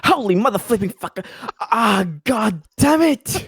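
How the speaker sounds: loud, high swearing outburst; mock frustrated scream. Player acting out a scientist's failed attempt, hammed-up angry scream. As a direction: curse the failed roll at full voice. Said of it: "and screams".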